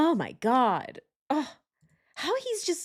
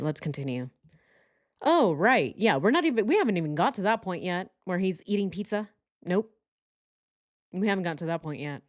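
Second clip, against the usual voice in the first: normal talking voice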